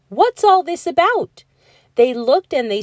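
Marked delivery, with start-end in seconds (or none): 0.1-1.4 high, raised, puzzled call; crowd shouting. A narrator's reading. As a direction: call out in astonishment. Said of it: "the people came shouting".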